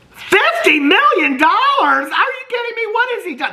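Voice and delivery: high, loud squawk of disbelief; shocked disbelief. Acted. Reacting to how much Jeff is worth, high shocked squawk. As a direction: shriek it like you can't believe the figure. Mock shock at host's fortune.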